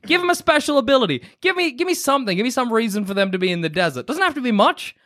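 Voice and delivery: high, exasperated plea; pleading. Retold or imitated. Pleading wish to the game.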